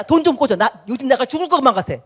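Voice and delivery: loud, pushy plea for money; blunt demand. Retold or imitated. Stranger demands a loan at first meeting, loud and pushy. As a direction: blurt the demand straight out, loud. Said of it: "보자마자 다짜고짜".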